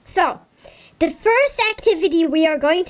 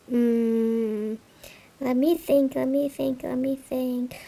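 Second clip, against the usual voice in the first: softer and softer, down to a murmur; mock thinking, fading; 4 semitones lower, 7 dB quieter than usual. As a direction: pretend to ponder, fading away. Pretending to think for kids.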